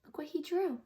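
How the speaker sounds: usual speaking voice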